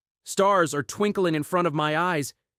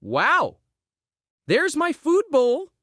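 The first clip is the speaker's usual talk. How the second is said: usual speaking voice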